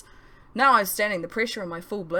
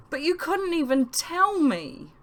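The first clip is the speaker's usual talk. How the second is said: woeful; 9 semitones higher, 3 dB louder, slower than usual. A narrator's reading. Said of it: "pure woe".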